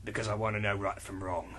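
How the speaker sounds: low, quiet, fast brooding hero; low, soft, quick tough-guy read; John Constantine voice. Acted. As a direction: mutter it like a weary detective. Reads character lines in low voice.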